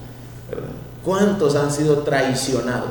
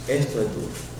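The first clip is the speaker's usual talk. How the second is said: hushed whisper; 7 dB quieter than usual. Real, not acted. Grave aside to congregation.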